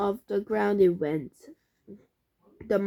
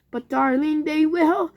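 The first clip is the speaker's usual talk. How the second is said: sob; 6 semitones higher, 6 dB louder than usual. A narrator's reading. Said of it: "sobbed Missus Fox".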